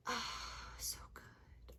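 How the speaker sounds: breathless whisper of delight; whispered awe. Real, not acted. Vlogger sighing over her favourite series in a whisper. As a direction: hush it like a secret treasure. Hushed rapture about the book.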